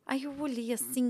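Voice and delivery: ordinary talk, nothing marked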